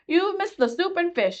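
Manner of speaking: high, bossy queen voice; Red Queen. A narrator's reading. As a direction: lord it over Alice from the head of the table.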